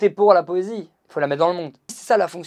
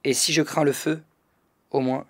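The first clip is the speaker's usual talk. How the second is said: measured, subdued poetry recital with pauses between phrases; poem reading; 4 semitones lower, 4 dB quieter than usual. Acted. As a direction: read your poem aloud evenly, letting each phrase settle. Reads his new poem aloud. (lecture de poème, posée et retenue)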